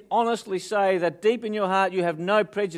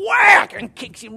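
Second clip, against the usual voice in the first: whack yelled out loud as the kick lands; shouted whack; 14 dB louder, slower than usual. Retold or imitated. Comic storyteller shouting the farmer's kick at the crowd. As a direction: bark the whack like a sudden blow.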